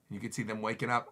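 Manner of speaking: usual speaking voice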